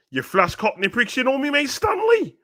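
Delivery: high, mock cockney football thug sneering; cockney hooligan taunt. Quoting football factory hooligans, mock cockney taunt. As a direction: jeer like a film hooligan. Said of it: "on a football factory thing".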